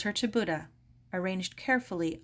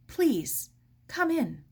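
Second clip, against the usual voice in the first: nervous; 7 semitones higher, 4 dB louder, slower than usual. Acted. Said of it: "Helen smiled nervously".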